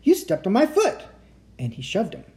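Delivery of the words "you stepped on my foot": high pained yelp, then shout. Acted. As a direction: yelp at a stepped-on foot. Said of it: "A monster behind him shouted".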